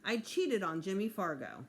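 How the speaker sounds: usual speaking voice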